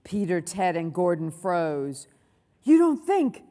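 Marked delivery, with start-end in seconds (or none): 2.6-3.4 higher, louder alarmed question; frightened gasp. A narrator's reading. Gordon frozen at unearthly screams, gasped alarm. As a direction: blurt it out in dread. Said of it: "gasped Gordon".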